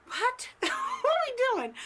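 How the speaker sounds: high-pitched mock disbelief with a laugh; incredulous. Reacting inwardly to Gary asking about falling out of bed, incredulous.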